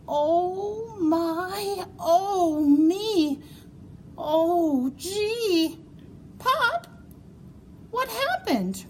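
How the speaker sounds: put-on high, alarmed child voice; a kid's worried cry, way up high. Junior crying out to Pop, high and worried. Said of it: "cried Junior".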